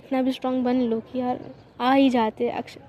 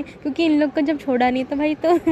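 normal talking voice

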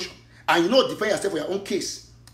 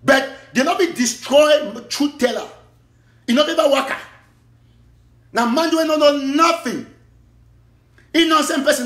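loud denunciation; 8 semitones higher, 6 dB louder than usual. Real, not acted. Opening rant, loud and slow.

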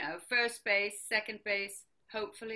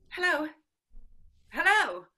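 loud, higher-pitched hello; calling down the phone; 5 semitones higher, 10 dB louder than usual. Acted. Mother trying to reach the President, loud insistent call. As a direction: shout to be heard on a bad line.

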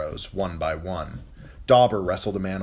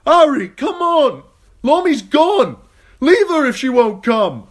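high, full-throated yelling; urgent shouting; 13 dB louder than usual. A narrator's reading. Hot Pie calling Arya from the burning barn, urgent yell. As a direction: yell frantically across the noise.

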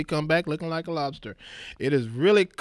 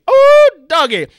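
excited; 13 semitones higher, 14 dB louder than usual. Real, not acted. Excited exclamation.

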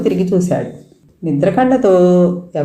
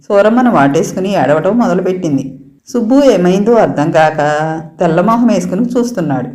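ordinary delivery

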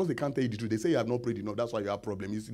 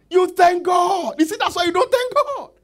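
much higher, loud preaching shout; 11 dB louder than usual. Acted. Preacher shouting at the church, very loud and high. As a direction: cry it out from the pulpit.

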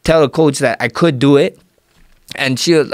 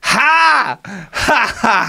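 high, scornful imitated ha ha ha; imitated high mocking laugh of the older brother; imitating brother's mocking laugh; 8 semitones higher, much slower than usual. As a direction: mimic a big brother laughing off a dream. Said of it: "he did this laugh that I'm not gonna ever forget".

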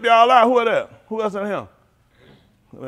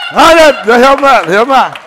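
loud, high hype yell; big shout; 7 semitones higher, 14 dB louder than usual. Acted. Comic urging kids on, loud high shout. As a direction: call it out to the whole room.

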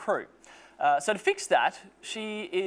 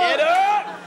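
high, loud sharp call; shouting; 8 semitones higher, 8 dB louder than usual. Acted. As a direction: yell it to rouse everyone. Shouting at the group.

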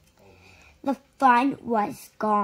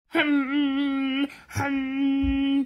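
humming sound. A narrator's reading. Narrator imitates the far helicopter, steady hum. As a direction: hum like something buzzing far off.

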